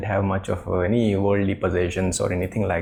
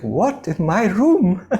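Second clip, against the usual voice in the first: high, loud outraged exclamation; indignant disbelief; 8 semitones higher, 5 dB louder, slower than usual. Retold or imitated. King to servants about a stranger in his room, high indignant disbelief. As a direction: act out a king's startled outrage.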